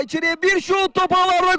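rising shout into the goal call; screaming football commentator. Real, not acted. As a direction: build up and yell the goal. Goal scored in live commentary.

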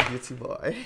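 ordinary talk, nothing marked

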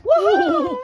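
loud high whoop; excited whoop. Real, not acted. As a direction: cheer out loud. Excited cheer at result.

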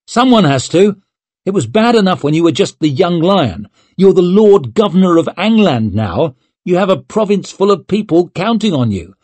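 high, insistent reprimand; heated telling-off. A narrator's reading. Friend lecturing a young lord on duty, heated. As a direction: press the point, exasperated.

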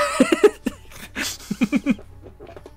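very high wheezing laugh; squealing, breathless giggles; laughing fit. Real, not acted. Streamer cracking up at an odd noise, high wheezy laughter.

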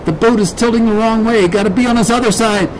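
high-pitched, hurried voice; urgent kid. Acted. Kid working out how to reach Dad, urgent. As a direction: talk it out urgently while steering.

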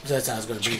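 ordinary talk, nothing marked

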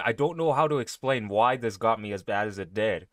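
ordinary talk, nothing marked

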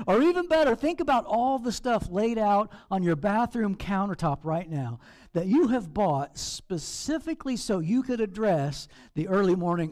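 ordinary delivery

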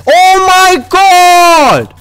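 high, long drawn-out squeal; panicked squeal. Real, not acted. Gamer taking storm damage, high drawn-out squeal.

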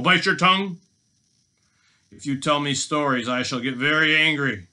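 loud robber's warning; hammed-up scolding villain; angry threat. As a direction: warn sternly, punching key words. Robber threatens his captive.

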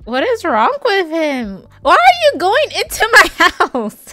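loud, high-pitched, incredulous yell; shrieking, comic indignation; outraged shouting. Real, not acted. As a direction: yell at the intruder, half laughing in disbelief. Outraged at neighbor walking into house.

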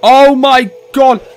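high, loud screamed protest; panicked scream. Real, not acted. Player in game danger, screaming in panic. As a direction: scream in alarm, climbing to the last word.

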